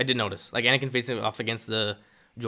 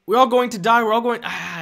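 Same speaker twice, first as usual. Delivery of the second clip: high, loud "We're all going to die"; mock panic shout; 11 semitones higher, 8 dB louder than usual. Streamer attempting Maul, high and loud. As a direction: shriek the doom like a hammed-up coward. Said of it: "I can't do Maul".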